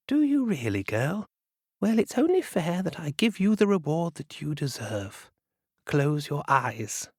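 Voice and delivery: high, slyly sweet reply; a witch hiding her scheme; knowing old witch. Acted. Witch answers the girl.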